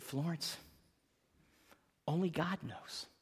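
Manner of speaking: soft, hushed reply; quietly retold bedside reply; gentle answer. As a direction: answer a dying friend gently, well under full voice. Pastor gently answers dying old woman.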